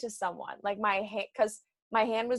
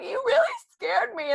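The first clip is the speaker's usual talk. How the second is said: tearful complaint, retold; 12 semitones higher, 4 dB louder than usual. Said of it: "I started crying".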